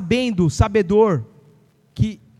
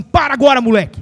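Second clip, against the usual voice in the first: put-on loud stern command to a child; firm scolding; 7 dB louder than usual. Shown as a demonstration. Wife coaching a mother to scold her son, loud and stern. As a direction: bark it like a strict parent. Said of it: "Você vai falar assim".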